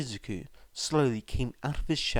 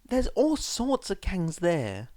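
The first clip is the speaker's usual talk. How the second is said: higher mother's voice, distressed; worried cry; 7 semitones higher than usual. A narrator's reading. Mother fearing gangs, a mild worried cry. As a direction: plead in worry. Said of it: "his mum cried".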